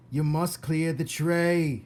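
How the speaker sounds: slow, loud shouted order. Acted. Cook ordering Mig, loud and slow. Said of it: "shouted Cook".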